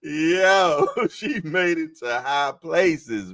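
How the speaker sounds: high-pitched, drawn out through laughter; laughing. Real, not acted. Host cracking up at a joke about high notes, laughing. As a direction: crack up while getting the words out.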